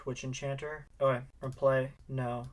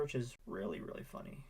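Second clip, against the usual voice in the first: trails off into a breathy whisper; really funny, said under the breath; fading whisper; 9 dB quieter than usual. Real, not acted. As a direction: let it drop to a whisper.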